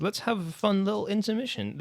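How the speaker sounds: ordinary talk, nothing marked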